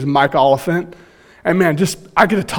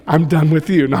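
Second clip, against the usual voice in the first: ordinary talk, nothing marked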